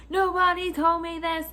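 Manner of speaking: high-pitched indignant whine; mock outraged complaint. Customer who missed his cash window, mock outraged. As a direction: imitate a customer losing it over a rule. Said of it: "he lost his shit".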